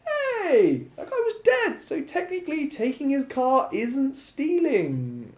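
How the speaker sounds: high, laughing cheeky excuse. Acted. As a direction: brush it off with a laugh. Lux cheekily excusing car theft.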